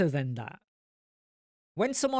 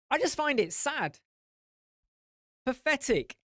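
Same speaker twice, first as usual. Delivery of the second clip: pitched up, heated scolding; exasperated rant; 5 semitones higher than usual. Real, not acted. Ranting at experts who revive old criticism, exasperated. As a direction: let the annoyance spill out.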